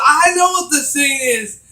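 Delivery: excited. Retold or imitated. Excited retelling.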